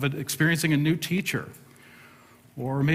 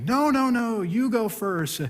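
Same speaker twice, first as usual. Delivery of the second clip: higher, insistent polite refusal; mock politeness; 9 semitones higher, slower than usual. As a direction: win the politeness contest. Politeness war in line.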